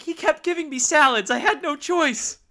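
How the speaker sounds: squeaky put-on voice for a joke; mock pregnant woman voice. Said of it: "It's like".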